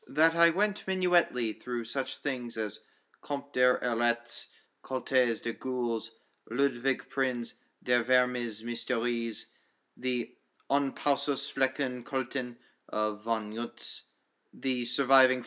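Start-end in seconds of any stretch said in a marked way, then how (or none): none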